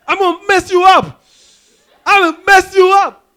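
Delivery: high, loud hammed-up threat; mock rapper threat. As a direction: play the tough rapper, far too squeaky.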